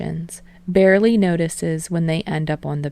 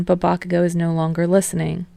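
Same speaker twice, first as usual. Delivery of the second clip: usual speaking voice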